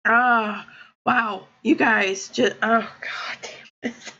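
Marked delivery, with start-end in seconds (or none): 1.6-4.1 tearful, breathless whisper; choked whisper. Real, not acted. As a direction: voice breaks to a whisper. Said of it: "I'm seriously crying".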